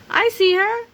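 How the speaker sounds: high, loud chick call. Acted. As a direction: call out in a little voice. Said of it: "Toto called".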